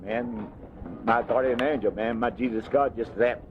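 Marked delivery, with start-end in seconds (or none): none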